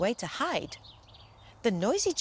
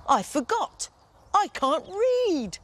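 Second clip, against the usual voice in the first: dismayed giant; 10 semitones higher, 3 dB louder, slower than usual. Acted.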